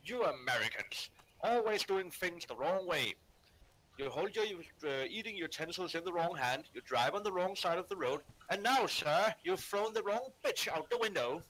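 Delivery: proper British gentleman's voice, rising in pitch; indignant, proper British posh accent. Shown as a demonstration. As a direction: scold like a posh English gentleman. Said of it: "take on a proper British posh voice".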